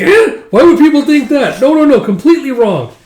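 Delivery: high, loud startled protest; shocked denial. Acted. Recapper voicing Parya, protesting loud and high when accused. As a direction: yelp it out, caught off guard.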